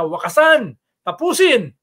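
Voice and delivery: loud chant leader's cry, rising in pitch; imitated rally shout. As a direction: call it out like a protest slogan. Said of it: "sinisigaw nila na". (ginagayang sigaw sa rally)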